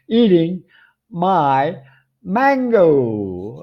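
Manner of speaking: high, word-by-word "eating... my... mango"; drawn-out punchline. Real, not acted. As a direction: pause before MY and MANGO, stretching MANGO in mock outrage. Comic reveal of the thief.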